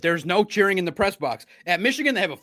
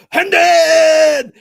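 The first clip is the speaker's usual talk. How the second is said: very loud, high yell of a name; top-of-lungs fan scream; imitated scream; 10 semitones higher, 13 dB louder than usual. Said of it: "he screamed it at the top of his lungs".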